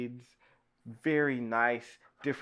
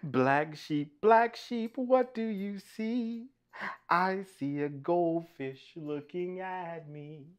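sing-song story reading; 7 semitones higher, slower than usual. Acted. Reader to children with picture book, sing-song chant. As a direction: lilt it musically for little listeners.

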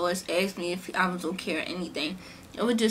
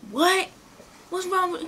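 high, alarmed "What?"; startled uncle; 9 semitones higher than usual. Retold or imitated. Uncle startled as his crying niece comes home. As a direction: jump in shocked and worried.

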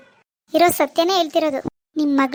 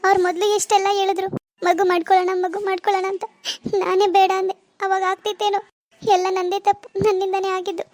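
put-on higher, tearful despairing young wife; tearful daughter; 4 semitones higher than usual. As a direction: complain to mom through tears. Daughter blames herself crying. (ಅಳುವ ಮಗಳು)

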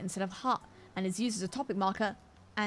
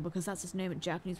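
ordinary talk

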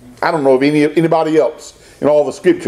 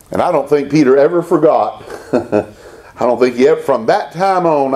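normal talking voice

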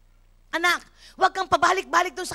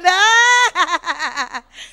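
a loud burst of laughter; loud shouting laugh; 6 dB louder than usual. Real, not acted. Laughing at own story.

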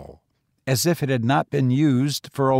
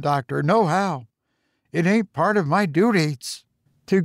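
lightly voiced old gardener, higher; a hint of a nervous old servant; 6 semitones higher than usual. Old gardener refusing to enter.

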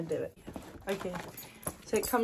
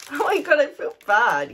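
laughing; 3 semitones higher, 9 dB louder than usual. Real, not acted. Laughing at herself.

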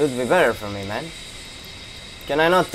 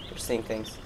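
much softer, hushed mock denial; hushed tease; 6 semitones lower, 13 dB quieter than usual. Player hiding from an enemy guard, hushed teasing.

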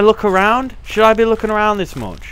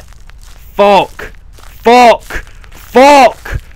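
scared yelling; 3 semitones higher, 8 dB louder, much slower than usual. Real, not acted. Scared player yelling in horror game.

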